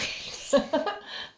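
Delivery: airy high giggle; high breathy laugh. Real, not acted. Parent laughing at child's answer, airy giggle. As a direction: laugh it off lightly.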